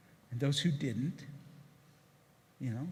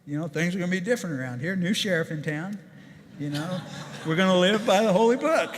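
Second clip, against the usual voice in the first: louder, faster, lightly pompous new-boss proclamation; hammed-up boss laying down the law; mock boss announcement; 3 semitones higher, 6 dB louder than usual. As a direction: announce it like the new sheriff in town. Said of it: "stood up in front of the whole plant and said".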